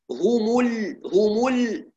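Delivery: هم ال with full damma on ha and mim. Shown as a demonstration. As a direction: keep the damma round. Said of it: "ضمة الهاء والميم نحافظ عليها". (هم ال بإتمام الضمة)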